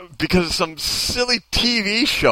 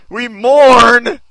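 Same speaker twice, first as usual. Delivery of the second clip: much louder, higher, rising on mourn; hammed-up loud mourning; 6 semitones higher, 11 dB louder than usual. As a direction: MOURN belted out high and loud. Repeats the read line, hammed up.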